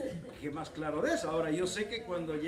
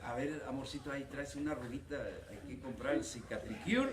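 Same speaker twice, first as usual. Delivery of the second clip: doting husband; 7 semitones lower, 7 dB quieter than usual. Acted. Preacher voices a doting husband.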